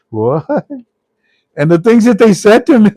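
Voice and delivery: high, loud, breaking into laughter; imitating laughing caller. Caller naming the bank that phoned him, imitated laughing. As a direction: mimic someone cracking up as he talks. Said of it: "The guy's laughing on the phone with me".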